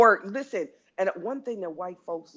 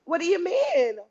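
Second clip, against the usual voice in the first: much higher, sweet and innocent; code-switch office voice; 11 semitones higher, 4 dB louder than usual. Retold or imitated. Talking to her bosses at work, code switching, pitched up high. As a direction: play dumb in a lifted sugary pitch. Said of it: "I'm straight up code switching".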